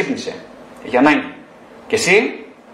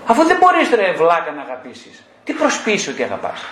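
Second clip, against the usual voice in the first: exasperated scolding; 3 semitones higher than usual. Acted. Therapist confronting someone who pretends to love, exasperated scolding. Mock scolding of a self-deceiving listener.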